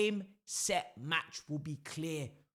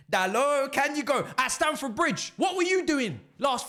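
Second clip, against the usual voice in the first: louder taunting call; 6 semitones higher, 6 dB louder than usual. Acted. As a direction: raise it, higher and louder. Taunting a rival defender.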